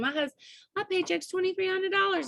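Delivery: high-pitched thrilled announcement; re-enacted excitement. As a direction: break the big news in a high voice. Re-enacts boasting to her husband.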